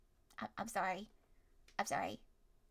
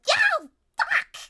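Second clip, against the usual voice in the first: shrill high yelp of pain; sharp yell; 14 dB louder than usual. Retold or imitated. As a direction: yelp like your fingers got snapped. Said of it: "you sharply yell".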